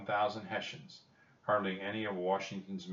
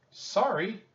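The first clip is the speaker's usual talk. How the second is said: very high, loud little squeak; squeaked apology; 14 semitones higher, 7 dB louder than usual. A narrator's reading. Jack apologizes to George Washington, squeaking. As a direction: squeak it like a caught boy. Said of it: "Jack squeaked".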